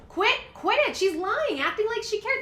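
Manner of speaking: really worked up at her; riled up. Real, not acted. Fired-up commentator venting at the politician, real heated anger. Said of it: "This is why I get so riled up about her".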